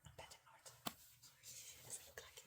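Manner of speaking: breathy unvoiced aside about her drawing; barely audible self-deprecating whisper; whispering to herself. Real, not acted. Teacher whispering to herself about her bad drawing.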